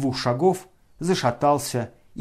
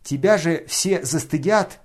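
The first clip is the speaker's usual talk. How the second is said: loud high shout of warning; 4 semitones higher, 5 dB louder, slower than usual. A narrator's reading. Nastasya shouting at Ganya to grab the burning money. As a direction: call out sharply across the room. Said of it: "кричала ему Настасья Филипповна".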